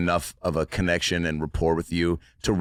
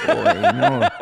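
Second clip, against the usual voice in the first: startled refusal; 7 dB louder than usual. Retold or imitated. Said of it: "pulled back so quick and was like".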